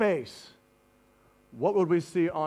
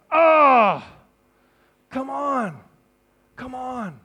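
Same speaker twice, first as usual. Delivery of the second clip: disappointed; 6 semitones higher, 5 dB louder, much slower than usual. Acted. Jesus wishing the disciples would get it, disappointed. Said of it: "Disappointment, right?".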